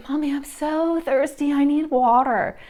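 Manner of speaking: put-on high, loud whining little kid; whiny child voice. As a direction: whine it like a thirsty toddler. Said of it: "in a whiny voice".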